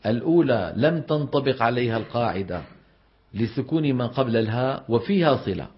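ordinary delivery

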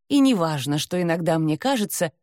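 ordinary delivery, nothing marked

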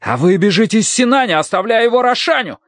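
high-pitched angry accusation; enraged, high outcry; furious shout. A narrator's reading. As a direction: hurl the charge at the minister. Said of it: "крикнул лучник, маленький разъярённый человек".

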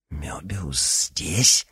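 slow whispered amazement; astonished whisper. Acted. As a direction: breathe the question in disbelief. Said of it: "изумился Никита".

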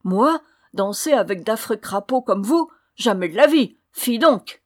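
loud, indignant disdain; scornful refusal. A narrator's reading. Haughty girl refusing to dance with the dwarfs, scornful. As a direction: reject them with outraged contempt.

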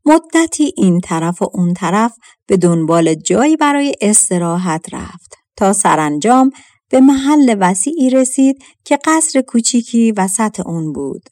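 plain reading voice

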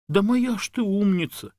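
high, gushing woman's voice; doting praise. A narrator's reading. Woman praising her dog on screen, gushing. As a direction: coo proudly over a clever dog.

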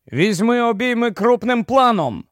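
restrained, high call to a crew member. A narrator's reading. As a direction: call the order across, without full shouting. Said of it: "гукнув Барні до Джино".

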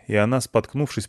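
usual speaking voice

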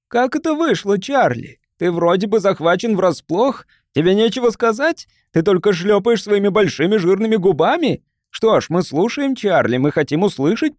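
high, mocking, pressing questions; angry interrogation. A narrator's reading. Angry neighbor cornering Charlie before the crowd, accusing. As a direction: taunt him with one question after another. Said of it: "но злость заглушила этот внутренний голос".

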